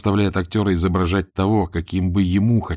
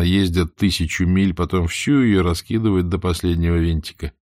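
ordinary delivery

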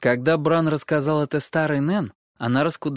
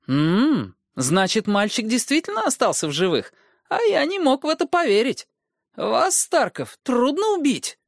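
sly dwarf voice; 12 semitones higher, 3 dB louder than usual. Acted. Tyrion addresses surviving boy.

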